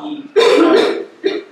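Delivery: high, loud, very slow wail; a drawn-out high anguished cry of the words. Acted. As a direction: cry it out to heaven in grief. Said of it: "we're crying out".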